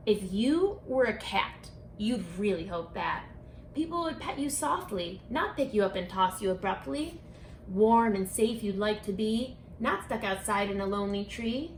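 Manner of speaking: plain reading voice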